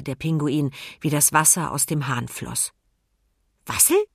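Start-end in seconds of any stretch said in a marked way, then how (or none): 3.6-4.0 high curious penguin question. A narrator's reading. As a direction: ask it wide-eyed. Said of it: "fragte er".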